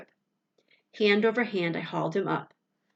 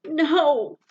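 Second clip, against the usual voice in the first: sharp high gasp of horror; 9 semitones higher, 9 dB louder than usual. A narrator's reading. Boy seeing his dog trapped, gasping cry. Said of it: "I gasped".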